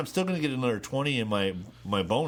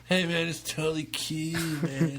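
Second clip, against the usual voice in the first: Californian hippie impression; 3 semitones higher than usual. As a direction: put on a mellow California dude accent. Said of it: "not an episode of The California".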